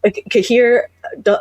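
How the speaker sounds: ordinary delivery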